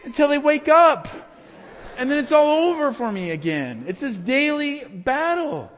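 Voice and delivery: high, loud fervent preacher cry; impassioned pulpit voice; preaching at full force. Acted.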